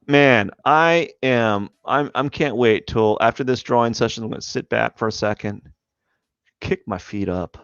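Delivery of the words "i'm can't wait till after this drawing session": ordinary talk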